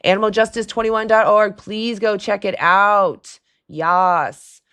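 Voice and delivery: ordinary talk, nothing marked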